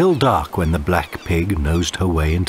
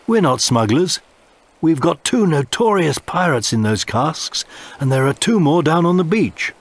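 put-on higher, earnest boyish plea; polite cabin boy; 9 semitones higher than usual. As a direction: plead politely. Tom pleads to officer.

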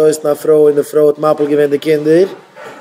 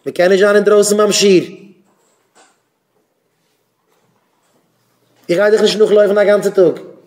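higher, fast stern rebuke; teacher scolding pupils who wander out; 5 semitones higher, faster than usual. Real, not acted. Teacher scolding pupils outside lesson.

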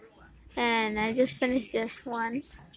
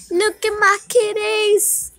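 cooing; 10 semitones higher, 8 dB louder than usual. Real, not acted. Cooing over the cats.

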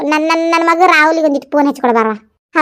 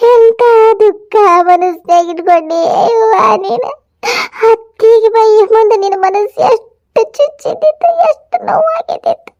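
high, slow and choked with tears; tearful crying; 6 semitones higher, slower than usual. Acted. Mother weeping to her daughter over her hidden hurt, crying. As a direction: weep over the pain she kept hidden.

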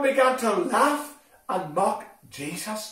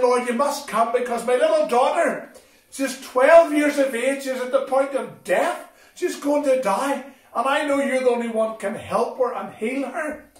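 raised, insistent pleading of a desperate father; urgent plea; 5 semitones higher, 5 dB louder than usual. Retold or imitated. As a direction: plead urgently for a sick daughter, pitch up. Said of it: "Jairus saw the urgency".

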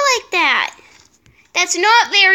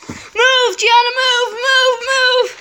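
高く大きく急かす; 動かないドラゴンを急かす; urgent urging; 6 semitones higher, 3 dB louder, slower than usual. Real, not acted. Urging her mount to move.

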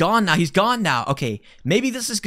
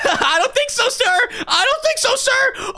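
panicked laugh; 12 semitones higher than usual. Real, not acted. Laughing panic fleeing monster.

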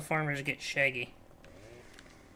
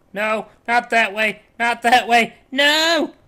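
high, loud protest building to a yell; real outburst of dismay, louder and higher; alarmed shouting; 8 semitones higher, 13 dB louder, slower than usual. Real, not acted. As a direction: shout at it to stop, climbing to the last word. Tree falls the wrong way.

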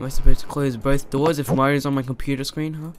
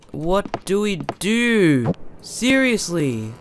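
slow, higher, drawn-out exasperation; frustrated; 5 semitones higher, slower than usual. Real, not acted. Gamer stuck without controls, frustrated wail. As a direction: stretch each word out in frustration. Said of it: "I'm getting so frustrated".

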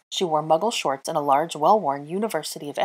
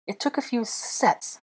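slow, higher, teeth clenched from cold; through clenched teeth; 6 semitones higher, slower than usual. A narrator's reading. Shivering witch admits her long journey, clenched teeth. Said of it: "through her clenched teeth".

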